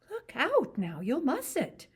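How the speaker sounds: lightly voiced ranch wife, teasing; Curly's wife. To Lenny about rabbits, lightly voiced curious woman.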